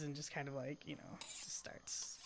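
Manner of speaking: soft whisper, slowing down; whispered narration. Real, not acted. Player narrating sword polishing to GM, whispered. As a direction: breathe it out quietly while polishing.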